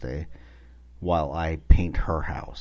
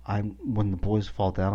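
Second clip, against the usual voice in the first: normal talking voice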